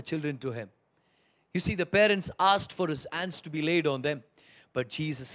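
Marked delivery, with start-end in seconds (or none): none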